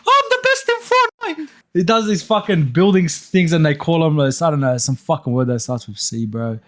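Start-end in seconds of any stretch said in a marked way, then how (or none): 0.1-1.5 very high, mocking little-kid squeal; nine-year-old voice. Cousin bragging about being best in Fortnite, nine-year-old voice. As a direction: imitate a boastful little boy, pitched way up. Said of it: "nine-year-old voice".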